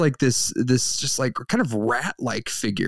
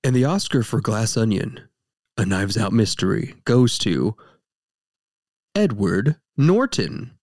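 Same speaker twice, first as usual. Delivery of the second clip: award announcer; 2 semitones lower than usual. Acted.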